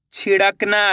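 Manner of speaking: ordinary delivery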